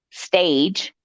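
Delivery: usual speaking voice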